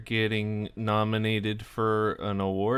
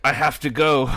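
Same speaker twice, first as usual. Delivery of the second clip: higher, louder, urgent exit line; hasty Frasier; 5 semitones higher, 8 dB louder, faster than usual. Retold or imitated. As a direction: blurt it out like you must leave now. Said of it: "And Frasier's like".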